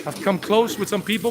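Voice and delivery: ordinary talk, nothing marked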